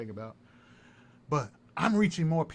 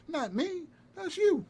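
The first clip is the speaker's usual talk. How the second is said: high accusing taunt; 14 semitones higher, slower than usual. Real, not acted. Streamer accusing his rival, high and mocking. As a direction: flip the blame right back at him.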